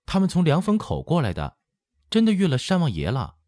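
put-on high-pitched little boy's excited call; young boy shouting news into the house; child calling out. A narrator's reading. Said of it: "半晌回头叫道".

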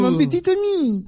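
put-on very high, hammy French accent; french chef. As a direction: squeal it like a cartoon French chef. Mock French after "maître" joke.

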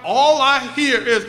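normal talking voice

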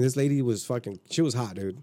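ordinary talk, nothing marked

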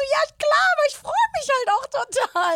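high, loud squeal of delight; retold delight. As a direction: gush with hammed-up joy. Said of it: "hab mich schon voll gefreut".

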